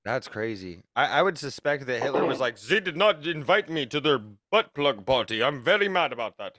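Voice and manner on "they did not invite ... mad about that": bad German accent. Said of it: "That's not a German accent at all".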